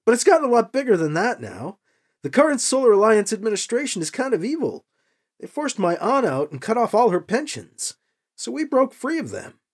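lightly voiced young rebel; young hero Alibi. A narrator's reading. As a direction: explain it, holding back anger.

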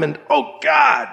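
acted agony on a drawn-out exclamation; agonised outcry. A narrator's reading. Hero overwhelmed by searing pain, agonised outcry.

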